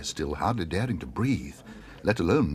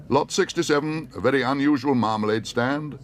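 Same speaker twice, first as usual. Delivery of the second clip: high, louder announcing; auctioneer; 6 semitones higher, 4 dB louder than usual. Acted. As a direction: present the lot to the hall.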